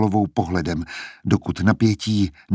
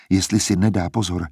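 ordinary delivery

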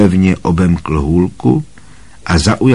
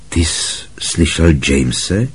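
a hint of strangled, quieter question; choked voice; 3 semitones lower, 4 dB quieter than usual. A narrator's reading. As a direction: ask it with a tight throat. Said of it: "podivně přiškrceným hlasem".

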